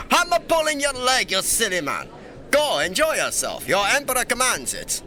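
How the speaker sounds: high, jovial royal command; playful sovereign waving off an attendant; amused, pompous dismissal; put-on emperor. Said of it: "It's so hard to keep that up".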